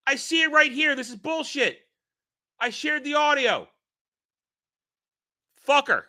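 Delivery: playful indignant rant at viewers; mock frustration. Real, not acted. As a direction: argue with the chat, fed up. Chat says audio missing.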